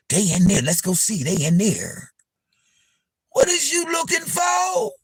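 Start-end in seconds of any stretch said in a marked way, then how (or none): 3.3-4.9 calling out. Real, not acted. Calling out to the person.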